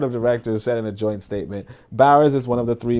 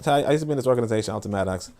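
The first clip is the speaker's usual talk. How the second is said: ordinary talk, nothing marked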